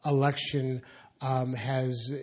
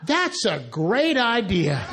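laughing retort; 6 semitones higher, 4 dB louder, slower than usual. Retold or imitated. Retort to racist caller.

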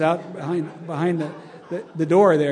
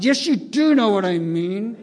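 loud, higher, exasperated police captain; louder insisting captain; 4 semitones higher, 7 dB louder than usual. Retold or imitated. Police captain pressing the singer, now loud and exasperated. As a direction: push it harder, losing patience.

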